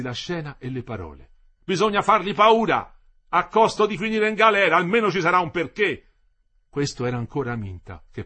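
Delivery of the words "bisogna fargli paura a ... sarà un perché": high, loud agitator's cry; loud, heated call to action; militant outburst. A narrator's reading. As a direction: urge them on at full voice. Striker urges force in dispute.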